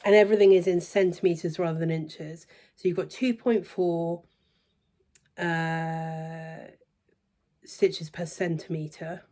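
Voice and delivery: ordinary talk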